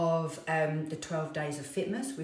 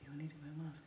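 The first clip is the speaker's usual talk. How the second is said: ordinary talk, nothing marked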